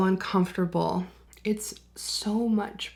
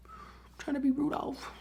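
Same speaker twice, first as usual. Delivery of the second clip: higher and softer, a cute little echo; playful higher repeat; 6 semitones higher, 7 dB quieter than usual. Shown as a demonstration. Vlogger joking about her red nose, higher and softer. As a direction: say it again up high and hushed. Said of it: "I'm trying to be Rudolph today".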